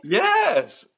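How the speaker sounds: very high, loud mock-insistent yes; high, punched, amused retold reply; emphatic yes. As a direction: squeal the answer as if it were obvious. Retold emphatic answer amid laughter.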